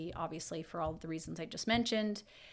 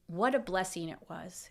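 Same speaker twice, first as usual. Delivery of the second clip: ordinary talk, nothing marked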